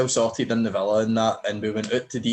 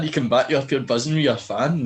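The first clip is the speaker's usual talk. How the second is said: ordinary delivery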